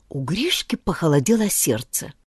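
ordinary delivery

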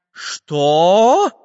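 loud, rising, drawn-out challenge; Samodelkin's menacing shout. A narrator's reading. Handyman challenges the grabbing spy, menacing shout. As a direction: roar it in indignant threat. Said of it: "грозным голосом закричал Самоделкин".

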